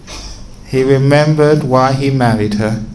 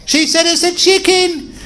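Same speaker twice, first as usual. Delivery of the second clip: very high-pitched mock insistence; squabbling wife. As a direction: squeal it stubbornly, an acted bickering wife. Acted escalating marital squabble.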